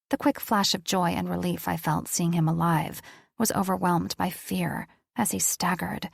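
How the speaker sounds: plain reading voice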